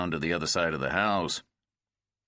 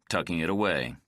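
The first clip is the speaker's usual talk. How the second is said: ordinary delivery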